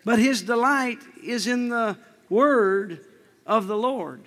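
very slow, drawn-out reading; slow scripture reading. Acted. Preacher reading scripture slowly to the church. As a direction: stretch each word of the verse.

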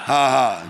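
low, deliberate ha-ha; put-on laugh, low in pitch; fake laugh. Shown as a demonstration. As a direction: demonstrate a laugh with two spoken ha's. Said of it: "Two joy is just to laugh".

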